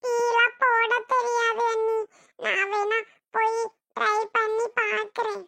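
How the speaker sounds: slow, hesitant admission; reluctant, hesitant. Acted. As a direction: drag it out slowly, giving in reluctantly. Reluctant reply to bossy sister-in-law. (தயங்கி மெதுவாக)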